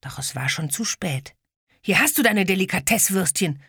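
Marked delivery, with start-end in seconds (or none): 1.8-3.6 furious. Acted. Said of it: "rief Ede wütend".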